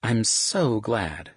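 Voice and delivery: slow, eye-rolling sarcasm; mother mocking Sebastian's boast; chiding sarcasm. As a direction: drag it out dryly. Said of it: "she chided with a roll of her eyes".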